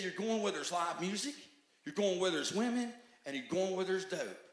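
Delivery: God laying out the danger; rising, forceful divine rebuke; stern voice of God. Retold or imitated. God's voice warning him.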